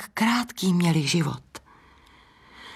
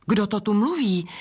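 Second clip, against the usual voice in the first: higher, mildly startled question; blurted question; 4 semitones higher than usual. A narrator's reading. Laundress hearing an unknown voice, blurting it out. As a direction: blurt it out with a touch of surprise. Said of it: "vyhrkla Pradlena".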